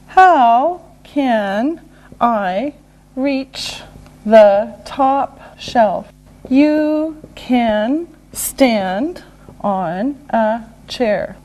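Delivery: word by word with pauses; slow and deliberate. Shown as a demonstration. Teacher modelling a how question and answer, slowly. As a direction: pause after each word for learners.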